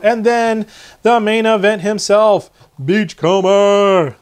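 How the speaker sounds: big drawn-out ring announcer reveal; playful showman's fanfare; announcer introduction. Pretend. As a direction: present the main event, stretching the name. Hyped introduction of toy.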